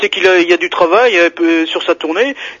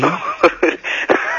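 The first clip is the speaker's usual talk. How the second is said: suppressed laugh; 4 dB quieter than usual. Real, not acted. Stifled laugh at caller's story.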